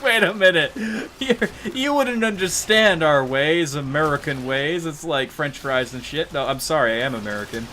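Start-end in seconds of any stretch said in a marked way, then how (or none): 0.0-4.8 high, loud mimicked objector; mock objection. People doubting the accent, loud mock objection. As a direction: blurt it as a laughing parody.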